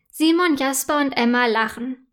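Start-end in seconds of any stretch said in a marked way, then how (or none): none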